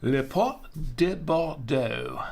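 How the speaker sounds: slow, hammed-up French accent; playful put-on French accent; fake French accent. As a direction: savour the wine name like a mock Frenchman. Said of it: "With my fake French an-, accent".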